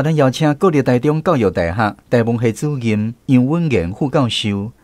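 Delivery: ordinary delivery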